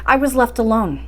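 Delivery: ordinary delivery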